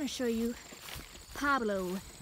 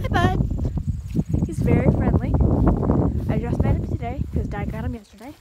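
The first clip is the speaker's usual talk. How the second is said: louder talk over the goat; 14 dB louder than usual. Real, not acted. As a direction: raise your voice over the noise. Loud near the goat.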